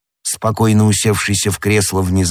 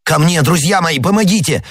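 high, urgent shout; frantic cry for help; 8 semitones higher than usual. A narrator's reading. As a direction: call your friends to the rescue. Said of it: "закричал Д'Артаньян". (отчаянный крик о помощи)

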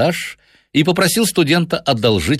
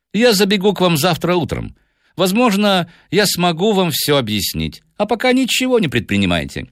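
high-set, confident detective voice; composed detective taking charge; Holmes reassuring his client; 11 semitones higher than usual. A narrator's reading. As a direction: give assured instructions in a higher register.

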